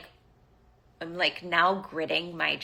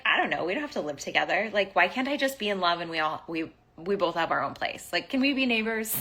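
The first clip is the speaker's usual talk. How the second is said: rushed pleading; faster than usual. Retold or imitated. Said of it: "I was like".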